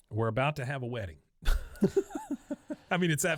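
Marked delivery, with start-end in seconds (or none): 1.4-2.9 high-pitched burst of laughter; real laughter. Real, not acted. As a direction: laugh at the absurd turn of the story. Laughs at the sudden wedding.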